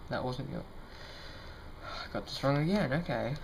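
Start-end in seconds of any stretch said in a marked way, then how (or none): 0.7-1.8 disappointed sigh. Real, not acted. Trick went wrong.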